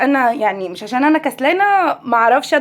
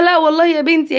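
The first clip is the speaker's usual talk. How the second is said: retold loud reply; 3 semitones higher, 3 dB louder than usual. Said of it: "قلت لها".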